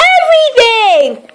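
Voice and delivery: mild mock horror; horrified. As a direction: recoil at the very idea. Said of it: "cried Little Miss Neat, horrified".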